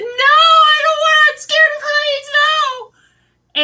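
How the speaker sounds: high, hammed-up panicked shriek; freaking out. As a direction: wail it in mock terror. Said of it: "freaking out".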